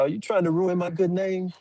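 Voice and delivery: high-pitched imitation of an offended father; indignant. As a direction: protest it high as the wronged dad. Retells dad scolding him.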